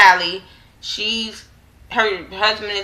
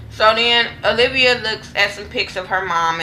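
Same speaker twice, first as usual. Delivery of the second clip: normal talking voice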